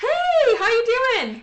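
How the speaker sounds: very high, bright-eyed greeting; a high-voiced guy saying hello; high-voiced date. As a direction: imitate a man with a very high voice. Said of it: "his voice was yours".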